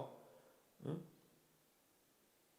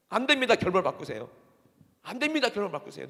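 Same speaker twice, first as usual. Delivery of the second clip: loud plea, each refusal starting high; protesting Peter; 6 semitones higher, 8 dB louder than usual. Acted. Preacher acting Peter refusing the death ending, urgent protest. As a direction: object urgently, then press the demand. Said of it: "베드로가 그런 거예요".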